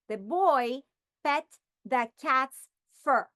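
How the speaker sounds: said slowly and clearly; slow model sentence. Shown as a demonstration. Teacher models a sentence slowly for students. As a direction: read the example slowly. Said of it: "we will say".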